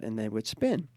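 ordinary talk

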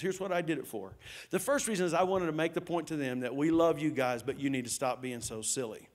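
usual speaking voice

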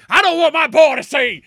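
hammy stage actor delivery; overacting. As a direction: ham up a tearful father's plea. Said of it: "In this most overacting way possible".

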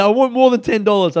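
aggrieved but controlled pushback; insistent complaint. Retold or imitated. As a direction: press the demand firmly without screaming. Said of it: "but I said".

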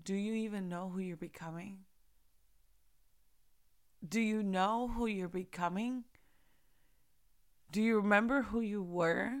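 quiet, gentle voice of the Lord. Retold or imitated. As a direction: ask it tenderly, giving each question room to land. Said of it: "the Lord so gently was like".

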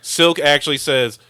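usual speaking voice

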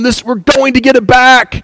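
excited, high-pitched crowd reaction. Acted. People reading of David's throne being restored, excited. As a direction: act out people thrilled at a promise. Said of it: "the people are excited and going".

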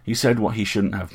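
ordinary delivery, nothing marked

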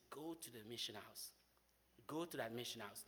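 soft, low, lightly voiced divine command; a hushed voice of God giving direction. God speaking to him on the walk, a soft low command. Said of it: "I hear the voice of God".

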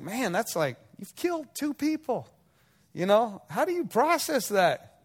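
lightly voiced friend asking the killer how he copes; questioning friend. Friend asks the prisoner, lightly voiced, mildly amazed.

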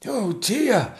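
high-pitched dismayed aside; a courtier's anxious thought, pitched high; worried Lord Chamberlain. Acted. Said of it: "thought the Lord Chamberlain".

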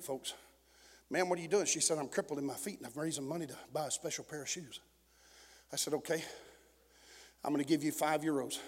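low, very soft pulpit storytelling; hushed testimony. Real, not acted. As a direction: drop the voice and draw the church into the story.

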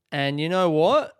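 ordinary talk, nothing marked

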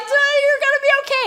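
high, fast, mock-soothing; reassuring mom. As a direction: reassure him while scared yourself. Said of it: "I'm like".

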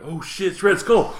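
high-pitched startled exclamation; mock shocked outburst; Captain America. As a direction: play the hero's sudden alarm. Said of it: "Captain America will be like".

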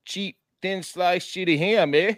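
hammed-up fake Canadian accent; terrible Canadian impression. Co-host keeps the joke going, badly faked Canadian accent. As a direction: lean into a clumsy Canadian accent. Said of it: "It's the worst impression of a Canadian ever".

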